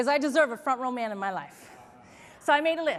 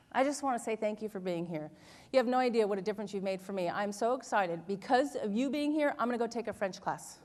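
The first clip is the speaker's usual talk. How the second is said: low, soft, earnest thank-you; quiet, heartfelt appreciation, retold; sincere thanks; 4 semitones lower, 5 dB quieter than usual. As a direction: speak gently and privately to one person. Acts her sincere thanks to the man.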